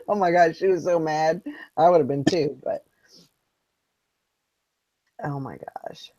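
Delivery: ordinary talk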